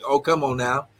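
normal talking voice

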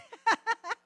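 high loud burst of laughter. Real, not acted. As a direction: cackle at the joke. Laughs at own joke.

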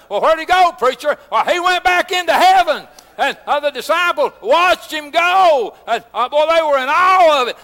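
ringing country sermon, rolling to a shout at the end; preaching at full force. Acted. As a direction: build the fervour toward heaven and the blood of the Lamb.

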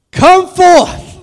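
high, loud ringing command; Jesus commanding the dead to rise, loud; clear command. Retold or imitated. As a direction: proclaim it to the grave. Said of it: "Jesus spoke in a clear voice".

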